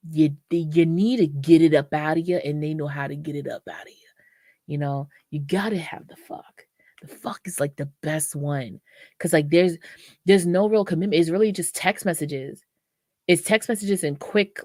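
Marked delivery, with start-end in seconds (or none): 2.4-8.8 hushed secretive whisper. Real, not acted. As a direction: lean in and whisper it. Confiding about the casual partner.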